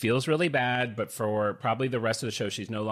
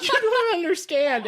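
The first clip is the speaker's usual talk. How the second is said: very high tearful plea, hammed up; put-on crying; 4 dB louder than usual. Pleading with usher to get things, put-on crying. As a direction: re-enact crying to get your way. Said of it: "I can kind of cry, like, to get things".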